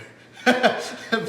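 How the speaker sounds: a loud, high laugh bursting out; real burst of laughter. Real, not acted. Laughing at the end of his own story, a loud high laugh. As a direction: laugh out loud, really amused.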